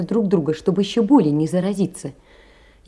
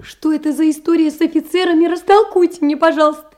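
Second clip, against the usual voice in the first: much higher and louder, pressing for an answer; urgent high demand; 9 semitones higher, 5 dB louder than usual. Acted. Varenka pressing Makar about the officers, urgently high. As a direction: ask it breathless and insistent.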